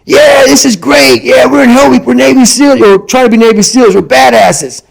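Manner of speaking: acting out a hyped-up, cheering trainee; having fun. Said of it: "So you're having fun".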